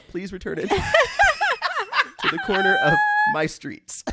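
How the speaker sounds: high, slow, laughing through the words; laughing plea. Real, not acted. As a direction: pleads while cracking up.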